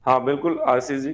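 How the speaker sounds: ordinary delivery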